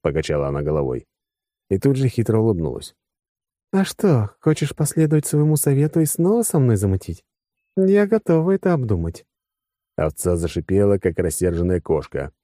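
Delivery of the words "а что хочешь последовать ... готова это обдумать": high, lightly teasing female voice; teasing woman. Acted. Woman slyly offering to get back together, high teasing voice. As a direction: make a sly offer in a raised pitch.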